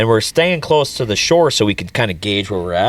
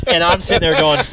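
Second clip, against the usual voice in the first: ordinary talk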